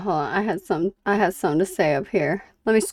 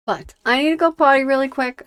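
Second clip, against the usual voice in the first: quick, louder hurried aside; rushed; 4 semitones higher, 8 dB louder, faster than usual. Real, not acted. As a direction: rush it out before stepping away. Hurrying off to the bathroom.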